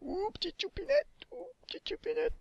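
very high, squeaky cutesy voice; cutesy cooing. Pretend. Cooing over "petite choupinette" in the game, cutesy squeak. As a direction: coo in a tiny falsetto. Teasing coo at a cute player.

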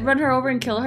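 ordinary talk

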